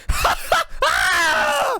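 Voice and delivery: a high, loud burst of laughter; shrieking laugh. Real, not acted. Gamer reacting to his guess, shrieking laugh. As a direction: let out a wild yelping laugh.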